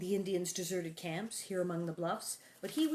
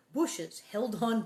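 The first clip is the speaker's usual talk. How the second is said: plain reading voice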